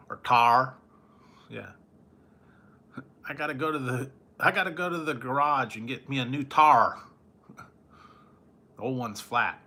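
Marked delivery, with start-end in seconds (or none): 0.1-0.7 loud mock Southern drawl on tar; a mock Southern country accent. 4.4-7.0 a mock Southern country accent. Mock Southern tire as tar.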